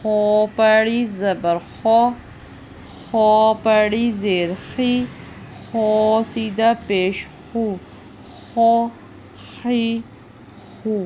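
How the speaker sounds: خو خی خو rasped full and thick; full, heavy خ sound; heavy kha. Shown as a demonstration. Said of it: "اس کو بھی پر پڑھنا ہے".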